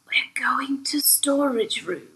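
slow, secretive whisper. Acted. Leading a child to storage room, slow whisper. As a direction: whisper the plan slowly.

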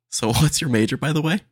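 laughing through a fake-casual question; half-laughing mock texter; mock casual ask with a laugh. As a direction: toss it off lightly, chuckling. Acting the texter's third ask.

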